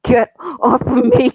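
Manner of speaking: high, loud, slow panicked yell; desperate shout. Acted. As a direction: scream it while struggling free. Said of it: "squirming and growling".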